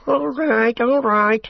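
very high-pitched orc squawk; placating orc tracker. A narrator's reading. Tracker giving in to the soldier's threat, squawking. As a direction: back off in a high voice.

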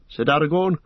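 plain reading voice

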